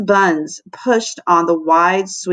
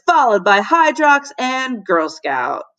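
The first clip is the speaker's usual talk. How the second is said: sports announcer; 3 semitones higher, slower than usual. Acted. Jackie narrating the cookie race at lunch, sports announcer. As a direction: call the cookie race like a live commentator. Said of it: "in her sport announcer voice".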